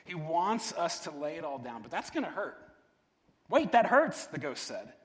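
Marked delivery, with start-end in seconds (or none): 3.5-4.2 high, loud, sudden objection; alarmed protest. A narrator's reading. Ghost to the angel who would kill it, alarmed protest. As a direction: burst out in alarm.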